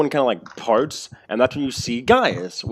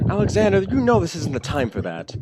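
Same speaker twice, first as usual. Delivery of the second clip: Nina rebuke; 8 semitones higher than usual. Acted.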